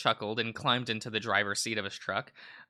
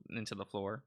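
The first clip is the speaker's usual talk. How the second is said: ordinary talk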